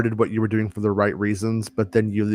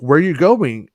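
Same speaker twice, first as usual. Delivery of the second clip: lightly acted, higher-pitched question; retold parent's question; 5 semitones higher, 4 dB louder than usual. Dad asking his kid where he's off to, retold higher questioning. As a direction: ask it up high, as in a retold exchange.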